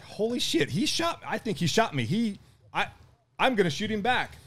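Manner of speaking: high-pitched retold disbelief; high, stunned mock outburst without extra volume; reenacted shocked realization. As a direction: act out the dawning shock, voice jumping up. Reenacts realizing he was shot.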